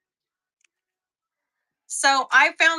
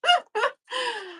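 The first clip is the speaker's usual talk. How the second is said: squealing laugh, high and helpless; high-pitched giggle; high embarrassed laugh; 13 semitones higher than usual. Real, not acted. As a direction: laugh it off, caught out. Embarrassed about looking pantless.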